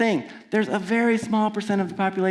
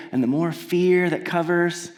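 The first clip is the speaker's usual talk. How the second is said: ordinary talk, nothing marked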